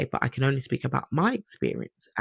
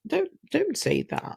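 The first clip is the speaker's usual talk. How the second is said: squeaky high plea to mum; high pleading. Retold or imitated. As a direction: pipe it up, begging her not to jinx it.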